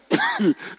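short high-pitched chuckle; brief high giggle at own joke. Real, not acted. Preacher joking to the congregation, chuckling. Jokes about three church visits.

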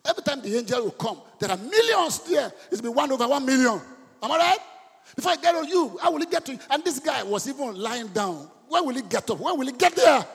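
high, loud sermon shout; full-force preaching. Real, not acted. Preacher pressing the congregation about the man lying down, full-force preaching.

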